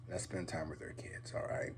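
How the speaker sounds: soft breathy whisper; whispered appeal. Real, not acted. Vlogger whispering his last plea to dads, intimate. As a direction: lean in and hush it.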